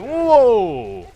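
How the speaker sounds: long, high, loud whoa; startled exclamation. Real, not acted. Player cut off mid-chat by game event, startled shout. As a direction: cry out in sudden shock.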